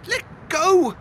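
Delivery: put-on a frightened boy yelping to be let go; crying out. A narrator's reading. Boy grabbed by the arm, crying out. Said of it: "cried Zach".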